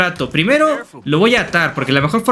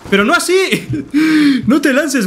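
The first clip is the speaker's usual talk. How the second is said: high, laughing protest; can't stop laughing at it; laughing disbelief; 6 semitones higher than usual. Real, not acted. Guy jumps off horse. (protesta aguda entre risas)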